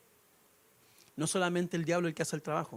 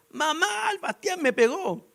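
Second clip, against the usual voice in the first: put-on high-pitched tattling wail of a little girl; fake-crying child calling for mom; crying little sister; 9 semitones higher, slower than usual. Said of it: "Y se pone a llorar".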